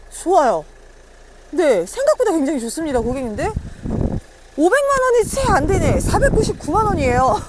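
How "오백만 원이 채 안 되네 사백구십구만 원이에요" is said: excited price shout. Real, not acted.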